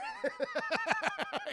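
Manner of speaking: long high-pitched giggling fit; real helpless laughter, very high; high cackle. Real, not acted. Laughs at own reveal.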